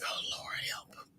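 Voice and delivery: tiny helpless whisper; whispered mock plea. Scolded speaker pleading to the Lord, whispered mock plea. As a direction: whisper a prayer under your breath.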